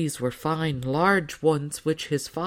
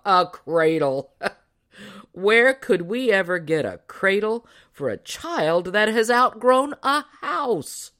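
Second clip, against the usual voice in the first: roaring with laughter; 5 semitones higher, 4 dB louder than usual. A narrator's reading. Father laughs off mother's cradle idea, roaring with laughter. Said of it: "He roared with laughter".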